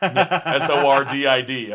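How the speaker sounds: slow, loud letter-by-letter spelling. Shown as a demonstration. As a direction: spell it out slowly and emphatically. Spelling out the misheard word.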